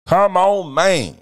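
hammed-up yell. Catchphrase yelled.

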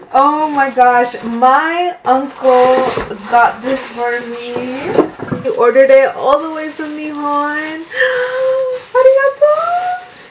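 slow, rising gush climbing to a high squeal; overwhelmed, thrilled. Real, not acted. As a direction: gush with growing emotion, gasp, then squeal. Overwhelmed by uncle's gift.

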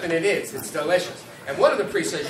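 normal talking voice

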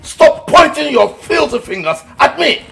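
very high, loud indignant shout; furious denial. Acted. Uncle denying the accusation, furious high shout. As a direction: scream the denial in outrage.

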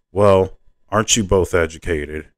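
ordinary delivery, nothing marked

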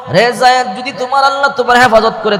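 high shouted taunt, rushing on; mocking shout. The hypocrite shouts a taunt at bound Zayed, mocking. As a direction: yell a sneering dare at him.

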